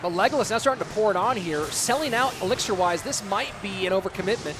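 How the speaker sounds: loud, rising caster energy; play-by-play casting. Acted. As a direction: call the action like a live caster. Live esports play-by-play.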